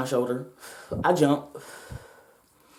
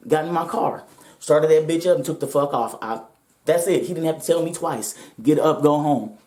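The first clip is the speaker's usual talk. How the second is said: normal talking voice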